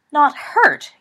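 loud, high, shocked question; gasped disbelief. A narrator's reading. Professor answers Remus's excuse, gasping in shock. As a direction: echo it back, aghast. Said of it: "gasped McGonagall".

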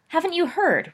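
high, loud smirking tease. Acted. Rosier goading Sirius in the hall, smirking. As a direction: toss it off with a smirk. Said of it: "Rosier smirked".

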